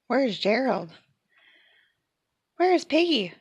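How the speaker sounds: ordinary delivery, nothing marked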